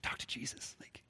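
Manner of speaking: unvoiced whisper, leaning in close. Peter secretly whispering to John, a soft whisper. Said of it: "Peter leans into John".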